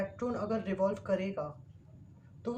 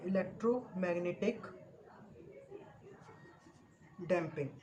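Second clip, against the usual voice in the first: term spelled out slowly; 5 dB quieter, much slower than usual. Shown as a demonstration. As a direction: dictate the term word by word. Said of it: "This is called". (शब्द को बहुत खींचकर धीरे बोलना)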